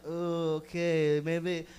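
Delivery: low, slow, timid regret; vulnerable backpedaling. Acted. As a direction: back down meekly and slowly. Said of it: "you feel vulnerable. You feel like".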